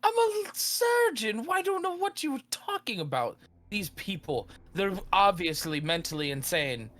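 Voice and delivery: very high, outraged gentleman's voice; indignant surgeon. Acted. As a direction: protest innocence, shrill with indignation.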